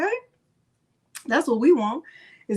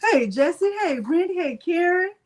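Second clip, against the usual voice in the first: usual speaking voice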